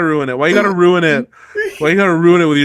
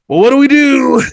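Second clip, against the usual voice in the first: mock panic; 7 semitones higher, 6 dB louder than usual. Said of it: "'cause, like".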